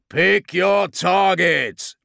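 slow, loud, high command; bellowed order. Acted. Captain ordering gunners in battle, bellowed order. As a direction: draw out each word over gunfire. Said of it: "ordered Dzansk".

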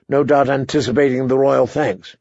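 plain reading voice

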